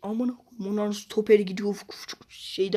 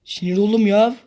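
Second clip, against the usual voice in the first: fed-up outburst; 2 semitones higher, 8 dB louder than usual. Real, not acted. Angry at the game.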